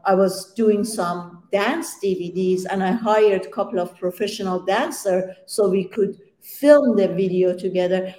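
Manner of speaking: normal talking voice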